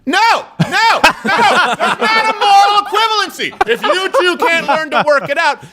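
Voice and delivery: very high, loud comic indignation; outraged rant. Real, not acted. Host objecting to the teacher's moral equivalency, high-pitched outraged rant. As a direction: protest at full pitch against a false equivalence. Ranting against the teacher's ruling.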